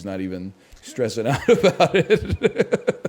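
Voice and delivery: words breaking into a laugh; can't hold it together, laughing through it. Real, not acted. Host cracks himself up over the pretzel guy, laughing.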